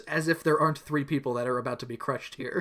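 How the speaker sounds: ordinary delivery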